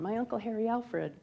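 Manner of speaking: ordinary talk, nothing marked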